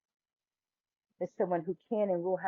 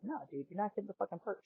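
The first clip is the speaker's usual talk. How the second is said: much softer, half to himself; inner voice; 13 dB quieter, faster than usual. Retold or imitated. Five-year-old silently defying the gunman, much softer. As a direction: keep it under your breath, defiant. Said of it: "I'm just sitting there like".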